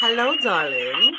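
slow, sultry purr of a greeting; breathy vintage purr. Acted. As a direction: purr it like an old-time radio siren. Guest's sultry on-air greeting.

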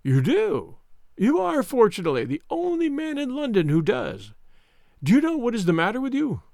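high-pitched, self-satisfied villain; Mister Smith, smug. Acted. Visitor who sniggered at sick Holmes, smug. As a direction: sneer at the helpless patient. Narrator voices sneering visitor.

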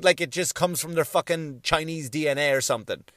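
ordinary delivery

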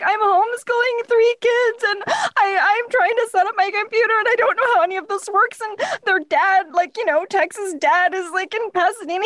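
high-pitched tearful rambling; retold sobbing. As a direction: pour it out like an uncontrollable crying fit. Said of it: "just sobbing uncontrollably".

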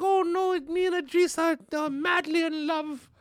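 high, swooning mock-British falsetto; Queen Elizabeth. The queen madly in love with Idris, high hammed-up British gush. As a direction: gush in a put-on regal voice. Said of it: "But she's like".

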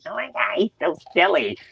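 high, silly put-on squeal; mock clueless fan. Host imitates people with no clue, mocking high voice. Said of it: "people that have no clue".

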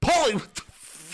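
high-pitched mock Paulie outburst; Paulie impression. Cast as Paulie, blurting out in a high mock voice. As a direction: play the grumbling brother-in-law.

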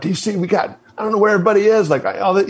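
high-pitched, flustered re-enactment; retold freaking out. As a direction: imitate panic rising, words tumbling into fragments. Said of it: "I start freaking out".

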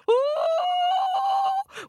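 very high frightened ooh; scared monkey squeal. Acted. Monkey facing the dogs, high scared squeal.

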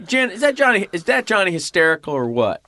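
high, eager dad voice; a hammed-up proud father; dad gushing about Johnny; imitating dad. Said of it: "and he goes".

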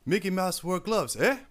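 deadly serious Nigerian delivery, tag on eh; Nigerian accent. Retold or imitated. Imitating his Nigerian homie Anua, serious slow Nigerian accent. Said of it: "if he's telling you".

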